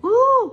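high, loud "ooh" of alarm; short high-pitched startled "ooh"; startled cry "ooh". A narrator's reading. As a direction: cry out like a startled little princess. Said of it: "cried the little princess".

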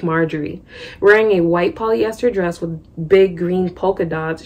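plain reading voice

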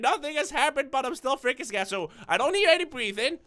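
ordinary delivery

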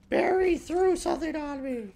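put-on high, loud tattling kid voice; mock little girl complaining; whiny little girl. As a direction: tattle like a wronged child. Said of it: "She's like".

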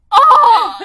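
very loud, high shocked oh; Home Alone gasp. Retold or imitated. Baby imitating Home Alone kid, loud shocked oh. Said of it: "it looks like the Home Alone kid".

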